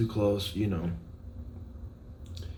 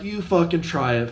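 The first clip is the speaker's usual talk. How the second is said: higher, louder retold threat; retold dare; 7 semitones higher, 5 dB louder than usual. Telling his friend how he dared the customer, louder. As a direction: snap back a dare. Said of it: "I'm like".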